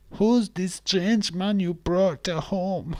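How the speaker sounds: high, drawn-out mock mum; disapproving mum. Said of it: "your mum would not like me".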